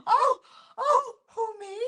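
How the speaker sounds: high mock surprise through laughter; shocked. Daughter re-enacting mother getting the call over, laughing shock. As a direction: imitate flattered shock while cracking up. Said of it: "she was just sort of shocked".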